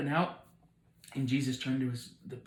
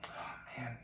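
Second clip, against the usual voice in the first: hushed dismay; 12 dB quieter than usual. Acted. Jesus hearing his friend is sick, quietly dismayed. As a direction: sigh the words out softly.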